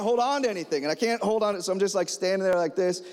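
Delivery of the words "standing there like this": usual speaking voice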